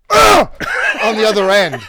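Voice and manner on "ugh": sudden loud, high grunt of effort; strained grunt. Pretend. Mimicking the helicopter jolting as someone jumps, loud grunt. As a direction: heave like a heavy weight jerks you. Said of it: "while something goes".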